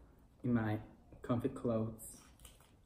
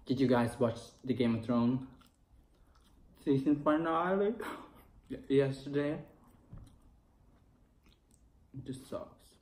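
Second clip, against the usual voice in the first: biting food mid-sentence; talking while chewing; 2 semitones higher than usual. Real, not acted. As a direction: mouth full of fries. Seen 2: bites fries at 0.8-2.1, keeps chewing and talking after.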